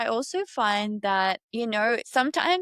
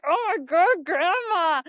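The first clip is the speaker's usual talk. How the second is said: higher and louder, exasperated oh my God; exasperated cry; 8 semitones higher, 4 dB louder than usual. Retold or imitated. Granddaughter reacting to her grandma's jab, exasperated and loud. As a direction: throw up your hands at grandma, loudly.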